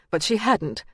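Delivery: usual speaking voice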